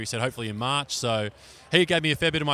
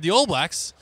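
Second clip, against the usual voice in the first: raised, louder protest at Tom; retold indignant outburst; 7 semitones higher, 6 dB louder than usual. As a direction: correct him loudly, half outraged. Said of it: "And I said".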